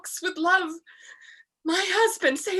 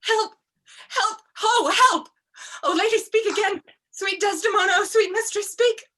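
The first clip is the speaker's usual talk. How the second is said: calling for help; 7 semitones higher, 3 dB louder than usual. Acted. Emilia crying for help.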